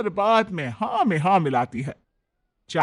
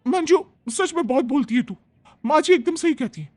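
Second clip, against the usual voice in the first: put-on higher, bossy young bride; young daughter-in-law; 9 semitones higher, 3 dB louder than usual. Bride scolds the maid Manju, higher and bossy.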